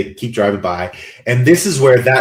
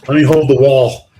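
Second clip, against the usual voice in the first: louder mock drunk, steadying yourself; drunken plea, raised voice; 5 dB louder than usual. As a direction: play someone who has had too much to drink. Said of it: "You feel like you're drunk".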